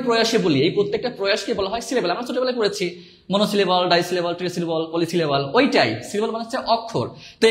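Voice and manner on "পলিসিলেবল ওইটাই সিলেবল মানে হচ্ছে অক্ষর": ordinary talk, nothing marked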